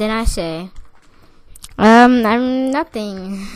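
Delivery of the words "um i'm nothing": loud, drawn-out know-nothing act; playing clueless. Pupil answering the teacher, loudly playing clueless. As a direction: stall loudly, then play innocent. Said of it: "like I don't know anything".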